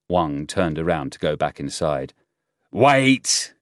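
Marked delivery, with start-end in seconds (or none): 2.7-3.5 sharp, high shouted command. A narrator's reading. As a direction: call out loudly to stop someone leaving. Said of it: "Shi shouted".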